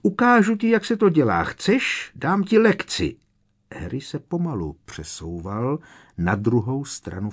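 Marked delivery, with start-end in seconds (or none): 0.0-3.2 high, loud mocking witch voice; a cruel witch teasing her prey; Bellatrix taunting. A narrator's reading. (vysoký posměšný hlas čarodějnice)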